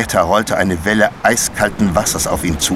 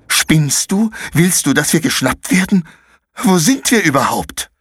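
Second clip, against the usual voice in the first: put-on much higher, urgent boy's hissed scolding; alarmed rebuke; 9 semitones higher than usual. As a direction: stop her making noise. Konrad reacts to the loud kick.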